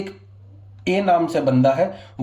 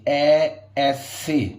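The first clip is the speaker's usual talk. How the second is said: slow demo; much slower than usual. Shown as a demonstration. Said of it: "तो ऐसे".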